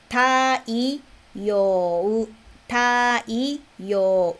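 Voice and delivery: "Taiyo" drawn out as ta, i, yo, u; syllable by syllable. Shown as a demonstration. Teacher breaks down "Taiyo" for learners, syllable by syllable. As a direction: spell out "Taiyo" slowly sound by sound, with gaps.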